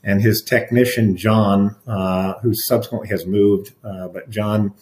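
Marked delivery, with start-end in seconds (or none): none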